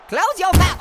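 loud mock yell. Gamer yelling at Ugly Joe to shut his mouth, loud.

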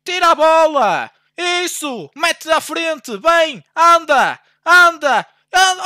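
high, loud, drawn-out urging; will the player forward with every word; urging shout. Real, not acted. Streamer urging his player toward goal, loud urging shouts.

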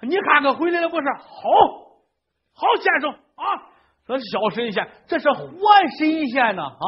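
put-on high, elated old man; overjoyed old man. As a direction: rave about the living immortal. Old man overjoyed praising fortune teller.

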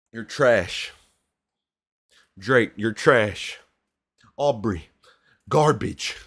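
loud, disgusted verdict; loud mock insult. Real, not acted. A fan yelling at [name] about the song, loud and disgusted. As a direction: shout the insult at him.

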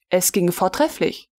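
plain reading voice

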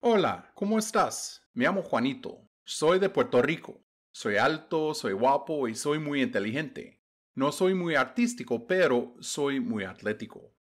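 natural everyday pace; just say it as in real conversation; conversational speed. Shown as a demonstration. Teacher reading the story at normal conversational speed. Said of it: "Now for conversational speed".

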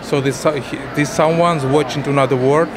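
Slavic-accented halting English; russian accent. Real, not acted. Painter describes his work.

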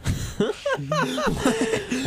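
high wheezing laugh. Real, not acted. Host breaks into laughter.

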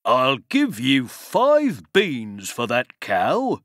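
slow, lightly voiced tempting stranger; a hint of a sly stranger making an offer. A narrator's reading. Stranger offering Jack beans for the cow, slow and sly.

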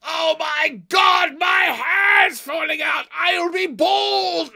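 theatrical screaming panic; hammed-up panic shout. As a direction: scream in fake horror at the hair. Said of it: "and just goes".

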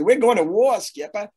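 high-pitched, hammed-up Maine accent; ridiculous Maine accent. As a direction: imitate the chief's Maine accent, dropping off on the last word. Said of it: "He had this ridiculous Maine accent".

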